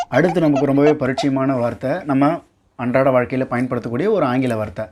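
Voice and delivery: normal talking voice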